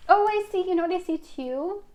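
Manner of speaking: suddenly high and loud, lit up; a sudden bright realization; excited. Real, not acted. Sudden vision during reading.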